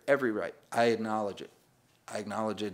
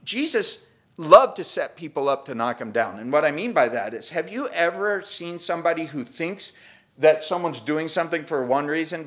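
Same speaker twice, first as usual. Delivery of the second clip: ordinary delivery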